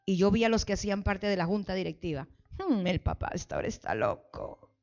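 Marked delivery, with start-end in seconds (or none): 2.6-4.7 sceptical grumbling. Acted. Board members doubting the pastor's plan, quiet grumbling. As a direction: grumble it under your breath.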